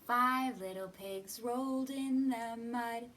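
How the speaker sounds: usual speaking voice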